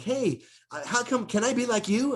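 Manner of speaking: high, eager, hyper voice; hyper young guy. Retold or imitated. Rocco eagerly asking his mentor, hyper and high. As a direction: beg eagerly like a hyper kid. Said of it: "I'm really hyper".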